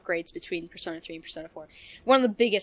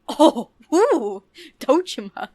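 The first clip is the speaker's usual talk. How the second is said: higher, louder swooning sigh over the name; mock swoon; 6 semitones higher, 9 dB louder, slower than usual. Gamer swooning over hot dad Dojima, hammed-up swoon. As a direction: ham up a lovestruck fangirl. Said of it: "Getting the vapors".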